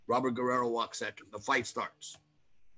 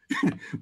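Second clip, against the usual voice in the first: high laugh. Real, not acted. Laugh after triumph.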